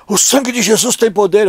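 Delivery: exclamação religiosa encenada, alta e aguda; mãe indignada. Retold or imitated. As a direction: imitando a mãe escandalizada. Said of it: "toda indignada". (indignação de mãe crente, voz levantada)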